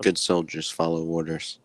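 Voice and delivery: flat obedient soldier line; clone trooper voice. Retold or imitated.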